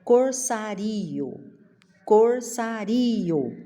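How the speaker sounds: "corsário" slowly as cor-sa-RI-o; split final vowels. Shown as a demonstration. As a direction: lean on ri, then a separate o. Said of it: "Se eu separar o rio".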